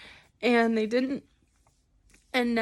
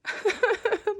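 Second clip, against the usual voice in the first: teary laugh; 8 semitones higher than usual. Real, not acted. Laughing through tears at herself.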